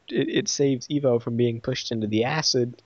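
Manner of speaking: usual speaking voice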